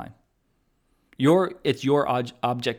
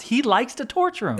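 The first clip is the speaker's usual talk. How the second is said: psychopath voice; 11 semitones higher than usual. Acted. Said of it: "Do you like my voices".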